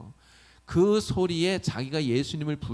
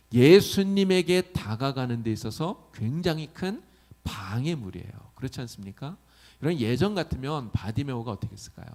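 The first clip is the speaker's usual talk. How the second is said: usual speaking voice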